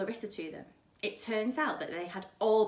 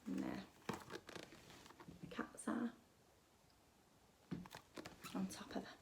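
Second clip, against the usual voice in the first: slow, hushed whisper pointing at the picture; whispered aside; 15 dB quieter, slower than usual. Real, not acted. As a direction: whisper it slowly, almost to yourself. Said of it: "aside showing the picture".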